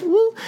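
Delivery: quick, very high whoop; a brief high-pitched cheer; short high "woo". Real, not acted. Short cheer after Pride.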